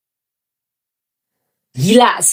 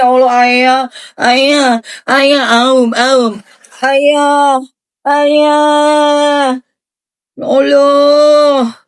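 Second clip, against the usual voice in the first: swooning moan; 4 semitones higher, much slower than usual. Real, not acted. Swooning over bags.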